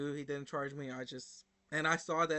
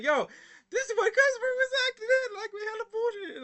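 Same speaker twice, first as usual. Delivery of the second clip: much higher, louder voice through laughter; laughing disbelief; 7 dB louder than usual. Retold or imitated. Telling his coworkers about the rude customer, laughing in disbelief.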